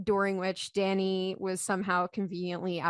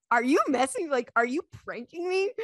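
high-pitched, agitated retold question; retold freaking out; 7 semitones higher, 3 dB louder than usual. Husband suspecting wife of a prank, mock freak-out. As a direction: imitate someone losing their cool. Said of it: "he's freaking out".

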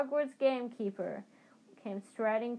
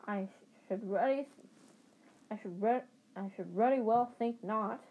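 ordinary delivery

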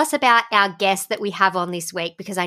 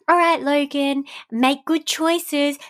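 slightly higher pep-talk voice; lightly imitated dad; 6 semitones higher, slower than usual. Dad sending his son off to daycare with advice. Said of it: "he goes".

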